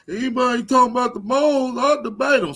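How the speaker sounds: high, loud mocking whine; mock him as if he's about to cry; loud, near-tearful caricature of a rival; whiny rival impression. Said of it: "Sound like he about to cry".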